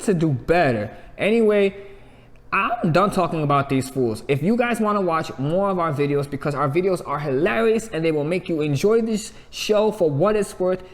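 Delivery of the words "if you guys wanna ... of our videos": ordinary talk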